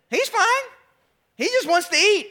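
high, lightly voiced calm wife; wife's voice. Wife reassuring husband baby just hungry, high voiced. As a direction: brush it off like a patient mother.

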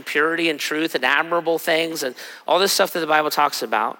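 ordinary talk, nothing marked

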